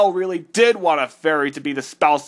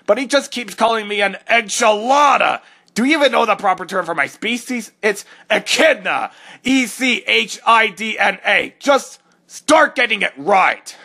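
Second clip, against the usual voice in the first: indignant rant; 4 semitones higher, 4 dB louder than usual. Acted. Indignant about species name.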